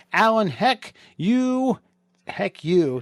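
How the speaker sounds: "heck you" with a long, high YOU; mock insult. Mock insult read out slowly.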